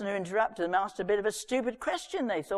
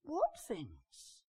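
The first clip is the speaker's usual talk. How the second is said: soft, much quieter innocent question; quiet stranger; 10 dB quieter than usual. Acted. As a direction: ask gently as if knowing nothing.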